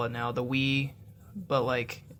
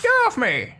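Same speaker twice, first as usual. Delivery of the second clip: gnome voice; 11 semitones higher, 6 dB louder than usual. Acted. Repeats the gnome's cry.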